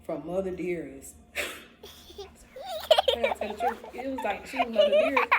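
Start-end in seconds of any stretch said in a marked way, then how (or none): 1.4-5.3 high squealing laugh through the words. Real, not acted. Confessing her crush, laughing high. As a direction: laugh it out, giddy.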